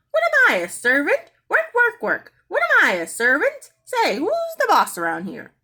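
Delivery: high, loud, squawking complaint; a cheeky parrot refusing chores; indignant parrot. A narrator's reading. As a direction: screech it like an outraged bird. Parrot talks back to daddy.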